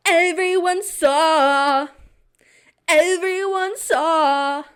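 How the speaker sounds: slow, high, loud dramatic wail; mortified. Retold or imitated. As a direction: draw out the horror of it. Mortified at public fall.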